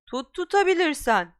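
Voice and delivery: Malfoy shouting a dare. Acted. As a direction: fling out the dare. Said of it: "diye bağırdı".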